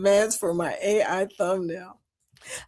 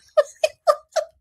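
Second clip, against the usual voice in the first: real high-pitched laughing fit; uncontrollable laughing; 4 dB louder than usual. Real, not acted. Cracking up at the casino lady's twang, helpless high laughter. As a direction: burst out laughing, unable to stop. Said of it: "I couldn't stop laughing".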